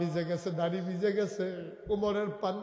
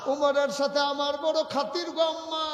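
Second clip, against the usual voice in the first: high, loud preacher's cry; acted fervent assurance; impassioned declaration; 11 semitones higher, 7 dB louder than usual. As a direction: proclaim it to the mother, voice raised. Umar declares it to the mother.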